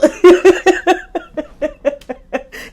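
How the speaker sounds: loud high-pitched laugh; real laughing fit; loud high laughter. Real, not acted. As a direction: burst out cackling. Cracking up at quote.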